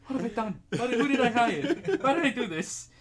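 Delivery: high, loud mock lament through laughter; regretful shrimp. Shrimp regretting the hire, high laughing mock despair. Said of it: "The shrimp is like".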